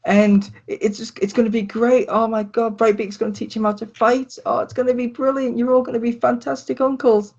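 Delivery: put-on very high, bubbly girlish chatter; excited girlfriend. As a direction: gush on in a squeaky girlfriend voice. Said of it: "And she's like".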